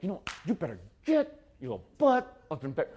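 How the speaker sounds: strained mock-angry parent order; Sunday-before-church voice. Parent hurrying kids before church, mock-angry strain. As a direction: put on a fed-up parent's threat. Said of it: "the Sunday morning before church voice".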